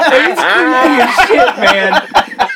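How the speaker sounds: high, helpless real laugh. Real, not acted. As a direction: crack up while getting the words out. Cracking up at the creepy stare.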